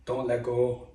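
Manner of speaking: put-on lightly done, unconvincing Austrian accent; Arnold Schwarzenegger accent. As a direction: try a bad Schwarzenegger-style accent. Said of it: "terrible Arnold Schwarzenegger accent".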